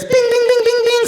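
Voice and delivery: high sing-song bings for little feet; bing bing footsteps. Retold or imitated. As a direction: chirp it up high and light. Daughter running up stairs sound.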